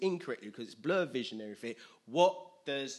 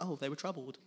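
casual, understated reading of "troubled"; light, dismissive "oh", then an unbothered "troubled"; mock mild concern; 4 dB quieter than usual. Shown as a demonstration. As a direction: toss off "troubled" as if it were no big deal. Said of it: "it's not like".

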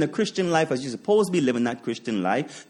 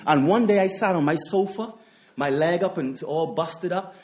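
usual speaking voice

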